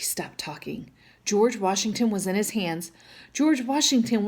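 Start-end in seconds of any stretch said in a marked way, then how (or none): 0.0-0.9 soft gasping whisper; shocked whisper. A narrator's reading. Narrator catching breath as Sam is caught, whispered.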